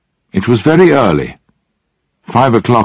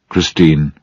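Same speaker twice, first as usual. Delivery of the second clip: ordinary delivery, nothing marked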